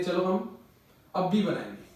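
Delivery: ordinary delivery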